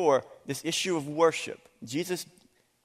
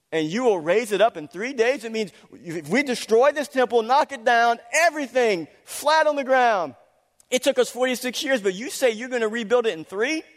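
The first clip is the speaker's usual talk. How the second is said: higher and louder, ramping up disbelief; incredulous mockery; 8 semitones higher, 6 dB louder than usual. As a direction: scoff at an impossible boast.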